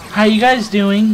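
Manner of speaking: drawn-out service voice; fake cheery hostess. Mocking the hostess greeting them at the table.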